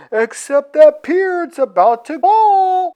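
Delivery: high, goofy cocky voice; goofy Iceman voice. Retold or imitated. Iceman reassures the reporters, high and goofy. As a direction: play a cocky dude brushing it off. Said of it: "But Iceman's like".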